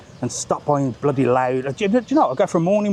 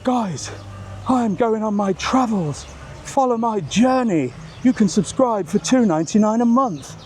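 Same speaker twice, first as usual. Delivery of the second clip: slow, higher influencer pitch; mock influencer; 6 semitones higher than usual. Mocking influencers asking fans to pay, slow higher pitch. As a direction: sell the journey like a grasping influencer. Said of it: "as in say".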